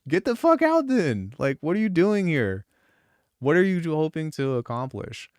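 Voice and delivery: high, loud mock outburst at a heckler; retold angry retort. Retelling how he told off a halftime hater, in a high, loud mock outburst. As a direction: throw the critic out, half joking and fired up. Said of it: "And I'm like".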